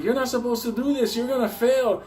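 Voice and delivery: high-pitched frantic scolding; mock panicked warning. Instructor warns the runaway driver, panicked. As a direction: imitate the frantic examiner.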